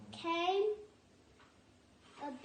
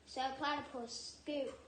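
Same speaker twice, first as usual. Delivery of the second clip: ordinary delivery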